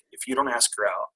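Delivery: lightly voiced Sam, low-key and sure; a hint of a friend's warning. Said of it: "Sam's voice in my head's like".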